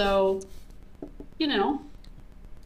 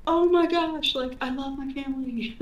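gushing excitement; 7 semitones higher than usual. Retold or imitated. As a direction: gush over the family like a teen. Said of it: "she's like".

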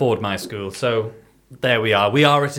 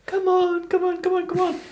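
high, sing-song coaxing; coaxing a toddler; 13 semitones higher than usual. Retold or imitated. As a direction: call him over like a small child. Beckoning a three-year-old from limousine.

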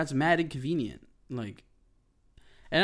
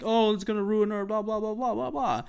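much higher, louder prissy whine; mocking whiny voice; 10 semitones higher, 6 dB louder than usual. Mimicking neighbors who fake parking concerns, high prissy whine. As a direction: mimic a fussy neighbor, pitched way up. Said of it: "don't say, like".

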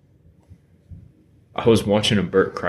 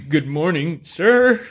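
mock polite greeting; 7 semitones higher, slower than usual. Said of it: "And so he was like".

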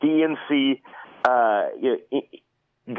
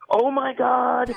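high, slow mock whine of the outraged left; mocking whiny liberal; 12 semitones higher, much slower than usual. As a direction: whine it like a pearl-clutching liberal. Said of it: "you see the left all of a sudden".

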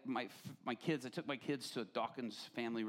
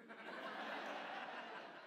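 breathy giggle; 8 semitones higher, 9 dB quieter than usual. Retold or imitated. Kids laughing at the nose.

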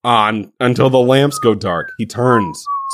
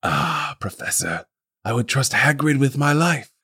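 put-on soft old wizard; 3 semitones higher, 6 dB quieter than usual. Dumbledore, soft and gentle.

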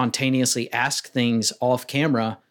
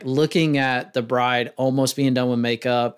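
ordinary delivery

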